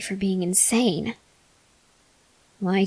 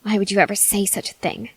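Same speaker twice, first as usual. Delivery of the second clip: usual speaking voice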